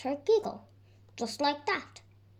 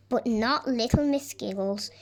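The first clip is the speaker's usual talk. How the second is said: ordinary delivery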